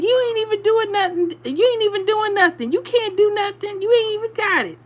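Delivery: high-pitched imitation of a mother-in-law's taunt; mocking mother-in-law. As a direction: taunt repeatedly in a high voice, mimicking her. Mother-in-law mocking through the door.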